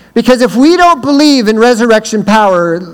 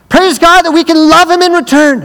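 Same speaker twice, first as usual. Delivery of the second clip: retold preaching, high and loud; rousing praise; 9 semitones higher, 3 dB louder than usual. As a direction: lift the room. Retold sermon line.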